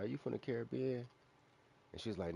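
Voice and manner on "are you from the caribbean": higher, quick asking voice; retold curious question. Asking the gal from Louisiana, higher and quick. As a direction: lightly act out the asking.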